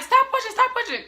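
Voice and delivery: very high, loud repeated order; high-pitched mock alarm; urgent command. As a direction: imitate panicked staff calling a halt. Staff urgently halting her pushing.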